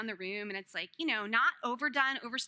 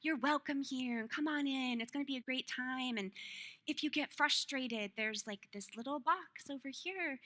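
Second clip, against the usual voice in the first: gentle teacher voice; 7 dB quieter than usual. Retold or imitated. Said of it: "communicating just such, like, love to Eden".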